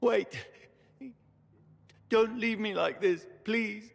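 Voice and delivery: desperate pleading. Acted. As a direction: beg them not to abandon you. Dying man begs not to be left.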